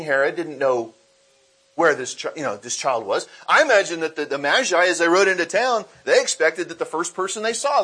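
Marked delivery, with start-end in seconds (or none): none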